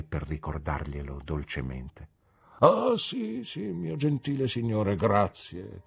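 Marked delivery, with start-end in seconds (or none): 2.6-5.8 high start, then fading thanks; flustered Micawber. A narrator's reading. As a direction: catch yourself and thank him. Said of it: "disse mister Micawber". (Micawber, colto alla sprovvista)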